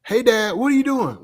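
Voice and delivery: father calling his son about the trash. Retold or imitated. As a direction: call the boy from downstairs. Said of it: "I said".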